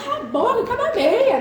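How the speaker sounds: half-joking burst of temper; mock yell. Frustrated mother jokingly venting at the crying child, mock yell. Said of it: "o fogo vem".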